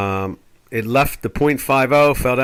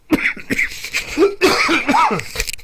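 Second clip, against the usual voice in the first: mock choking cough, high and forced; theatrical fake cough. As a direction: cough as if the word chokes you. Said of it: "makes me choke".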